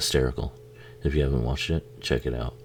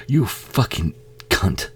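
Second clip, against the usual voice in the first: snarled insult; 8 semitones higher, slower than usual. Real, not acted. Angry at killer on screen.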